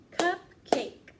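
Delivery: Cupcake said high and loud in two beats, CUP-cake; clapped syllables. Shown as a demonstration. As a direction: clap each beat of Cupcake. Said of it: "Let's say it together and clap with me".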